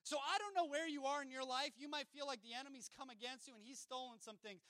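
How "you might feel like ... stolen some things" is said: usual speaking voice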